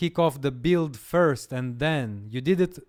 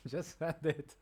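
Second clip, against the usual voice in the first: laughing; 10 dB quieter than usual. Real, not acted. Laughs at the AI's eagerness.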